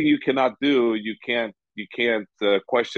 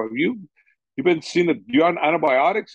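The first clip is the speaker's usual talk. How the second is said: higher, urgent questioning; retold alarm; 6 semitones higher than usual. Coach asks sick fighter about antibiotics, higher and urgent. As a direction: press the fighter with worried questions.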